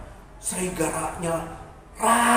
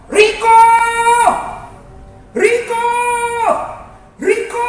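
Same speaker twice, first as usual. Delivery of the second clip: shepherd calling out; 12 semitones higher, 8 dB louder than usual. Acted. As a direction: holler for a lost sheep. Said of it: "itu suara dari gembalanya".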